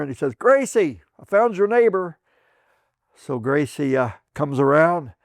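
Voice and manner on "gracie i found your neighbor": high, raised call to his wife; calling out across the ward. Retold or imitated. Walter calling across the ward to his wife, raised and high. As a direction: call out across the room, pleased. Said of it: "he yells out in there".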